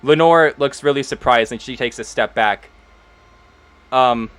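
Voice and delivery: normal talking voice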